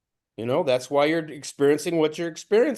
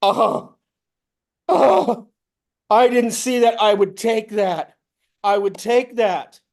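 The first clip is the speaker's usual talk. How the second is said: grab the offer gladly; delighted yes; eager agreement; 7 semitones higher, 4 dB louder than usual. Retold or imitated. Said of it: "I go".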